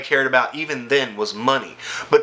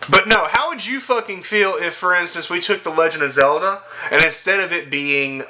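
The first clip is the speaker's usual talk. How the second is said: usual speaking voice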